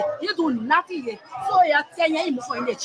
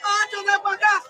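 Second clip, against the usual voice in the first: calling a name in distress; wailing the president's name; anguished crying out; 4 semitones higher than usual. Real, not acted. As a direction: cry the name out in despair. Market woman crying out president's name.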